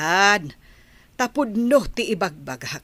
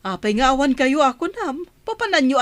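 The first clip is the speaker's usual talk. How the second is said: surprised; 6 semitones higher, 3 dB louder, faster than usual. Acted. Grandmother surprised they are leaving.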